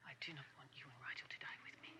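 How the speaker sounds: quick, soft, urgent whisper. Acted. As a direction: hurry it out under breath. Secret plea to friend.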